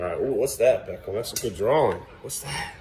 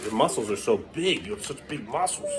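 ordinary talk, nothing marked

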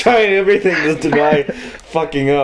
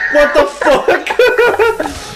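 laughing disbelief; much slower than usual. Real, not acted. Reacting to mother voice.

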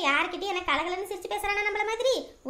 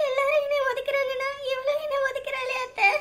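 high, crying voice; tearful pleading; 7 semitones higher than usual. Acted. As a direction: sob out your hurt to your aunt. Mother rejected by baby. (அத்தையிடம் அழுகையுடன் புலம்பும் குரல்)

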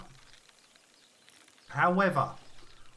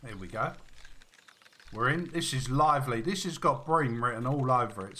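normal talking voice

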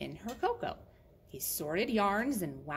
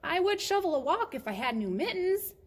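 put-on boy's bargaining reply; 10 semitones higher, 5 dB louder than usual. Boy's reply voiced in story.

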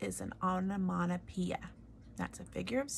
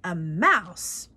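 louder royal bellow of outrage; bellowing king; 10 dB louder than usual. Acted. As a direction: roar it in disgust. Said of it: "bellowed the king".

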